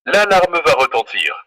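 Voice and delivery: usual speaking voice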